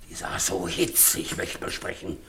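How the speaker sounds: gossiping about the woman quietly; muttered aside. Acted. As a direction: let it slip under your breath. Sly aside.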